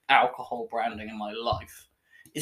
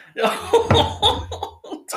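high squealing laugh; 6 dB louder than usual. Real, not acted. Tasting awful beer.

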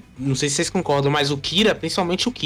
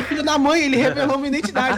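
higher, seething inner voice; a higher, seething anime villain voice; Kira inner rage; 8 semitones higher than usual. Retold or imitated. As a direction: boil over inside while hiding it. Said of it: "dentro do Kira ele tá estourando".

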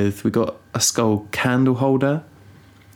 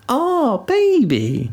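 put-on much higher cooing toddler voice; imitating toddler; much slower than usual. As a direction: coo at the cat like an excited little kid. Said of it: "whenever she sees the cat".

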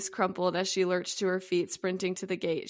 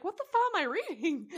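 bewildered; 8 semitones higher than usual. Retold or imitated.

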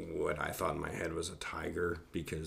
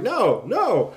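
high, loud cry of protest; acted-out alarm, yelled at full voice; panicked shout; 13 semitones higher, 12 dB louder than usual. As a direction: shout it like an emergency. Stands up yelling in retold trip.

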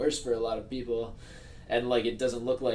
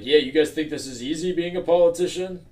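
higher, louder put-on politician voice; folksy pandering politician; 6 semitones higher, 8 dB louder than usual. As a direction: play a smug politician defending himself. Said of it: "I love your politician voice".

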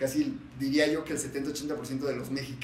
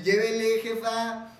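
higher, louder tianguis hawker call; market vendor; 8 semitones higher, 4 dB louder, slower than usual. Pretend. Imitating a clothing seller at the tianguis, loud hawking call. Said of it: "del güey que vende paca".

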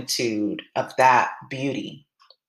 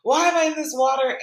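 high-pitched panicked self-questioning; retold freak-out; 12 semitones higher than usual. Nervous swimmer just got into the water, retold freak-out. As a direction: replay the panic in a high, rising voice. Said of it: "I start freaking out, like, freaking out".

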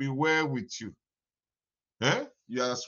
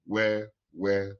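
normal talking voice

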